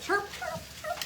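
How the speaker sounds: high, thin peeping chirps; high chick chirps. Shown as a demonstration. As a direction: squeak it out like a tiny chick. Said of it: "Make the noise".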